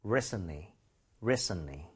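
"Recently" with the T all but silent; low, soft "Recently", said as RE-sn-lee; T nearly dropped. Shown as a demonstration. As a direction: say "Recently" with no audible T. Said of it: "T 사운드가 거의 안 난다".

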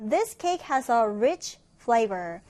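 very slow, clear reading of the English sentence; slow, careful classroom read-aloud; slow model reading. Shown as a demonstration. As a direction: read it slowly for learners to follow. Teacher reads model sentence aloud.

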